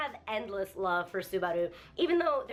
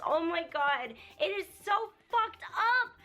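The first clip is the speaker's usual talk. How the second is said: real anguished outburst; 6 semitones higher than usual. Real, not acted. Vlogger reacting to a painful anime scene, anguished outburst. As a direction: pitch jumps high, voice strained with hurt. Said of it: "I'm so emotional and I'm so hurt".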